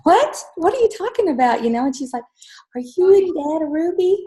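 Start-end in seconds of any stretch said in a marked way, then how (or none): 0.0-1.6 surprised. Retold or imitated. Mother retells her surprised reply. 2.8-4.2 put-on child voice. Daughter's question voiced high.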